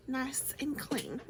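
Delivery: slow admiring whisper; hushed and slow. Real, not acted. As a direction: breathe it out slowly, admiring. Admiring clean brushes.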